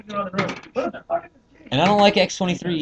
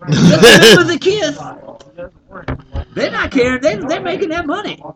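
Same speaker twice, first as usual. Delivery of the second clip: rock star voice; 11 semitones higher, 5 dB louder than usual. Acted.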